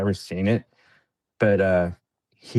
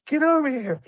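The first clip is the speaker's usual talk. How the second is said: retold yell. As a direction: bark it like a sergeant under fire. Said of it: "Master Sergeant Humphreys".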